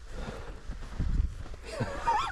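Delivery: high giggle of disbelief; high breathy laugh. Real, not acted. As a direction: laugh out in amazement. Amazed at the deep snow.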